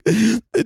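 sharp high gasp; dramatic gasp. Retold or imitated. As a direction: gasp in mock shock. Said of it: "being like".

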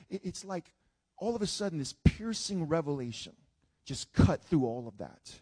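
ordinary talk

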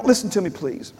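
normal talking voice

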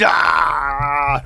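long loud mock scream; freak-out scream. Imitating the host freaking out at the microphone, screaming. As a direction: let out a drawn-out yell of "aah". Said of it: "He's just freaking out".

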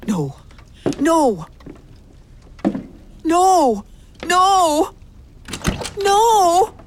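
finding Agnes dead, rising from disbelief to a horrified cry; rising horror. Acted. Horrified discovery of body.

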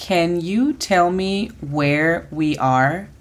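slow English, "you" lifted; slow model phrase. Shown as a demonstration. Teacher introducing the last phrase slowly. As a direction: ask "Can you tell me where we are?" slowly. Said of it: "tenemos la frase".

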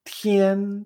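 "tian" high and level; sustained high "tian"; first tone. Shown as a demonstration. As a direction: hold "tian" on a steady high note. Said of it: "primer tono".

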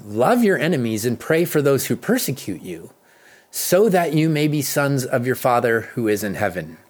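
normal talking voice

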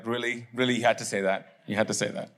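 low, softly voiced mock wife; imitating wife. Wife reacting to his Baptist joke, imitated reproach. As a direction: put on a low, deadpan wife's reproach. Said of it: "Ash, my wife is gonna".

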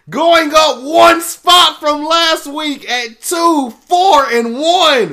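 high, loud and slow, milking every word; big hype announcement. Acted. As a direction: announce it like a stadium MC, drawn out. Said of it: "We've come to the moment".

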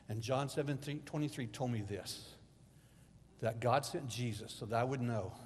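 ordinary talk

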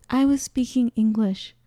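usual speaking voice

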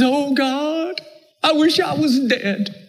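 high, whining wish to be dead; whiny self-pity. Acted. Elijah's self-pitying prayer.

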